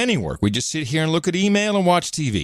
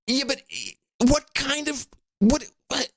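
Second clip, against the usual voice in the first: mock incredulous voice; 10 semitones higher, 3 dB quieter than usual. Mock voice scolding co-host.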